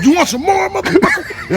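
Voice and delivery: loud, high taunt, laughing. Retold or imitated. Standing over the fallen man, loud taunt.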